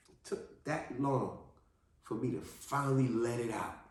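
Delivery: usual speaking voice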